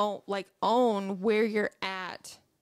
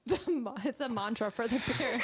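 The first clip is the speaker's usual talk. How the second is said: helpless laughing; 2 semitones higher than usual. Real, not acted. Father echoing co-host's mantra joke, laughing helplessly. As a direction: repeat it while cracking up. Cracks up repeating co-host's line.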